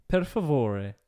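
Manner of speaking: mock-Italian flourish; Italian accent. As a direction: put on Italian, landing on the final vowel. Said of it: "the last syllable is always pronounced".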